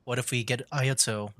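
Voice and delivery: ordinary talk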